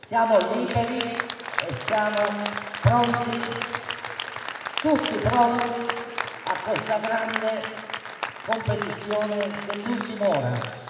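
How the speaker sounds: rising rally cry, loud and high; booming balcony speech. Real, not acted. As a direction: rouse the crowd, build to a roar. Rousing crowd at election rally.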